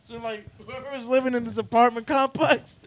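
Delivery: ordinary talk, nothing marked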